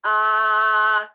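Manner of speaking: "Ah" held long, loud and level; doctor's-office ah. Shown as a demonstration. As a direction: open up and hold "Ah" for the doctor. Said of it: "what the doctor makes you say".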